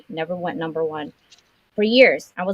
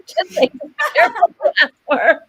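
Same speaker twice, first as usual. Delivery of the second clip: high, loud laughing delivery; 8 dB louder than usual. Real, not acted. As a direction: crack up while repeating your own punchline. Laughs while repeating her punchline.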